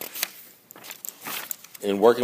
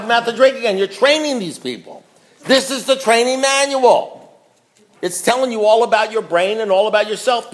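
fired-up preaching; 6 semitones higher than usual. Real, not acted. Scolding the room of sponsors, impassioned and raised. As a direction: preach it like your life depends on it.

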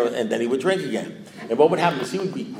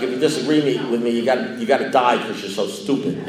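normal talking voice

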